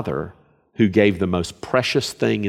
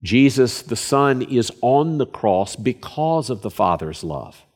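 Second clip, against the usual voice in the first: ordinary talk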